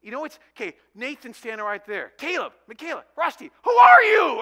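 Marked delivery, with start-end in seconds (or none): 2.2-4.4 exasperated shout. Retold or imitated. Father mixing up his kids' names.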